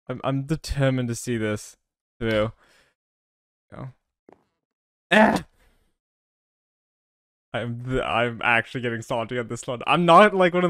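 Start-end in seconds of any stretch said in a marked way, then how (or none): 5.1-5.4 short, loud high yelp; frustrated cry. Real, not acted. Player failing the jump, frustrated yelp. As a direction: let out a sharp cry of frustration.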